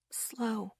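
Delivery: a breath of a word, barely voiced; hushed warning to the carriers; whispered. A narrator's reading. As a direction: whisper it, careful and slow. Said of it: "Asher whispered".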